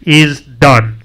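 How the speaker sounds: put-on slow, heavy Eastern European accent, loud and flat. Mechanic calling about the car, thick Eastern European accent. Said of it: "the thickest Eastern European accent ever".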